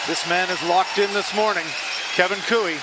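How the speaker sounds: excited commentary. Real, not acted. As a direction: lift with excitement. Commentator excited about shot.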